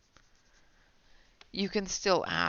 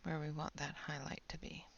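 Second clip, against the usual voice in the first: whispering; 9 dB quieter, faster than usual. Real, not acted. Whispers while coloring on stream.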